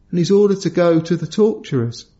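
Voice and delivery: normal talking voice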